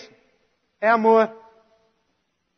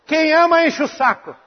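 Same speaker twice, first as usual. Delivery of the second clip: chuckling through the punchline; amused, half-laughing delivery; 4 semitones higher than usual. Real, not acted. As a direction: laugh as you say it. Pastor repeats punchline, laughing first.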